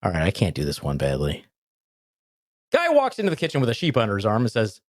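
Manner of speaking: ordinary talk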